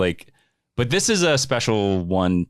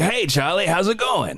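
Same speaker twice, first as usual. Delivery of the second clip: higher, lightly voiced old man; Grandpa Joe voice; 9 semitones higher than usual. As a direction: greet Charlie like a creaky grandpa. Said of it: "so my Grandpa Joe voice".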